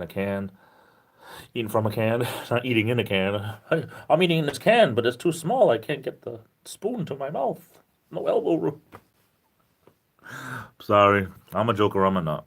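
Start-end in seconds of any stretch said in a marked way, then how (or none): 3.7-8.8 high, goofy voice of someone stuck in a can; silly voice. Pretend. Joking to chat about eating from a can, high goofy voice. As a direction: ham it up as a cramped can-dweller.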